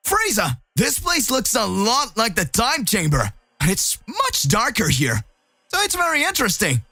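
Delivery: put-on keen to start, lightly boyish; eager hero. Voices Goku, curious and eager.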